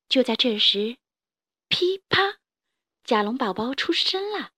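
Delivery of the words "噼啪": high cracking pop; squeaky egg crack; cracking sound. Acted.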